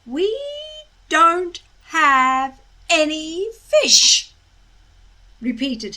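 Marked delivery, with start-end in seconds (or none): 0.1-4.3 loud, slow, through gritted teeth. A narrator's reading. Hiccup repeats to the dragons, through gritted teeth. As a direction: force it out through clenched teeth. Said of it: "between gritted teeth".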